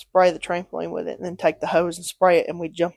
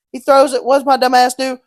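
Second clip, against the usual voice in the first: ordinary delivery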